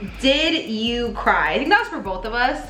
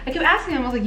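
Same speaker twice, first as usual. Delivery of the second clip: ordinary talk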